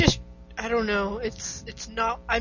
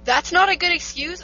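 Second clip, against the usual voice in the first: higher, louder incredulous question; indignant echo; 7 semitones higher, 6 dB louder than usual. Real, not acted. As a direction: throw the words back in disbelief. Echoes friend's verdict, taken aback.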